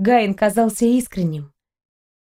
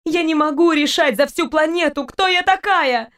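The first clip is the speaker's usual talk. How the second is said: voice breaking; 7 semitones higher than usual. A narrator's reading. Christina refuses to decide for the planet, voice breaking. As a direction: cry it out as your voice breaks. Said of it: "срывающимся голосом проговорила Кристина".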